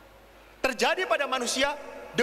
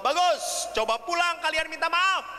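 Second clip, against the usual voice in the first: high, rousing approval; emphatic praise; 7 semitones higher than usual. Retold or imitated. Priest telling repentant children to go apologise, high emphatic approval. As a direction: send them off with an emphatic push.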